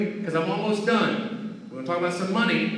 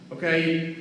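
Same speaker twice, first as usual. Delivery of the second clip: ordinary talk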